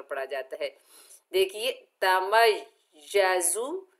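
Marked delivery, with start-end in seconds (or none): 2.0-3.9 तमय्यजु with the ya held and z whistled; doubled ya, hissing z. Shown as a demonstration. Teacher showing the shadda in तमय्यजु. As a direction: lean on the yy in तमय्यजु. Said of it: "सख्ती और सीटी से अदा करेंगे".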